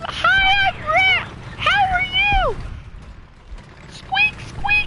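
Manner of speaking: very high squeaky rat voice; squeaky rubber duck. Pretend. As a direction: squeak like a tiny cartoon rat. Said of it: "I envision us talking".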